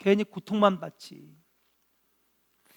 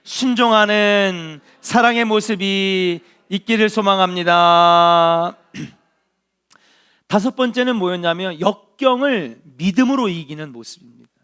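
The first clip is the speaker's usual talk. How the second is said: preaching at full force; 2 semitones higher, 11 dB louder than usual. Acted. Pastor urging the congregation on, preaching loudly. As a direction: preach it out to the whole hall.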